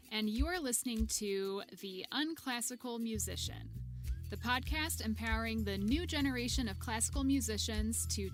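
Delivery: bright upbeat intro read over music; podcast intro. Acted. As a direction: announce the show like a host.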